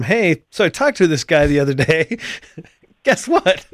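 mock gossipy brother, laughing; playful gossip. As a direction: spill the juicy secret, chuckling.

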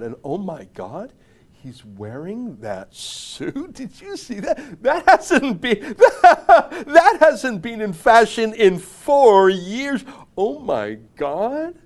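high, drawn-out tittering through put-on laughter; mock snob. Snob gossiping about someone's outdated suit, mocking titters. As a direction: sneer and giggle like a scandalised snob. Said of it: "if you're about snobbery".

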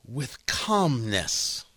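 very slow, hushed and low; demonstrated calmness. Shown as a demonstration. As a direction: draw it out softly, dropping low to model calm. Demonstrates the calmness being described.

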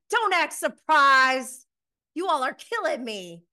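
pissed-off retort, high and loud; loud, high-pitched mock indignation; Sheree. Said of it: "Sheree is pissed".

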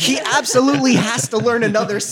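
high-pitched, exasperated insistence; emphatic outburst. Retold or imitated. Emphatic, incredulous agreement with the quote.

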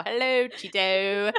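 slow, drawn-out sing-song greeting; mimicking the kid. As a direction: tease like the kid does, stretching it out. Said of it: "my new favorite way to say it now".

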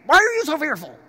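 loud, high-pitched scolding; harsh rebuke. Acted. Jesus rebuking his fearful disciples, harshly. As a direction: demand it harshly, like a furious master. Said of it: "Maybe Jesus said it harshly".

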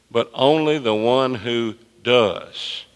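slow read leaning on "does"; slow down and land on "does"; pointed slow scripture read; stress on does. Shown as a demonstration. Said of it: "circle the word does right there".

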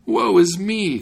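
high, loud theatrical lament; storybook cry of distress. A narrator's reading. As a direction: wail the woe aloud.

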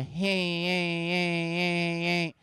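droning engine whine in repeated pulses; imitated car noise. Car owner showing mechanic the noise, droning imitation. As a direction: mimic the faulty car, softly and steadily. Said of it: "I have to do things in noises".

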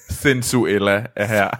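usual speaking voice

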